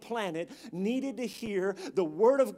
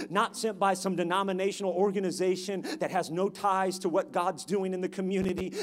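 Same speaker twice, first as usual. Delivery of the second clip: ordinary delivery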